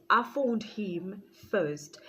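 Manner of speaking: slowly stressed correction. Real, not acted. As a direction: spell it out slowly. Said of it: "correction of order".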